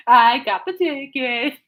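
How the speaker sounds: slow, high, loud playground taunt; laugh, then crow it in a teasing singsong; gloating singsong taunt. Retold or imitated. Telling sister about the [name] ticket, gloating singsong.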